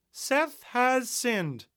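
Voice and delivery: high, loud, very slow pronouncement. Acted. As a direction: proclaim it over her interruptions. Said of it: "the host raised his voice".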